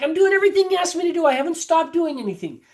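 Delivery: fast, high, exasperated protest; imitating a patient ticked off at her doctor; retold angry outburst. Patient protesting to her doctor, fast and high. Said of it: "she got angry with me".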